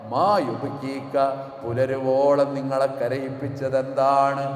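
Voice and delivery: loud, slow sung sermon chant; fervent melodic preaching with recited verses; preaching at full force. Acted. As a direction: pour out the story as a rising chant.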